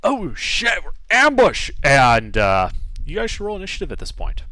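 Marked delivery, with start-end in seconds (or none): 0.0-1.7 high-pitched cry of ambush; acted alarm yell. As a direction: raise the alarm as a startled guard. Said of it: "he yells out".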